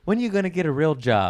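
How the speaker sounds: lightly voiced, quick nagging mum. Mum questioning her son's career, lightly nagging. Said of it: "My mum's compliments are the best".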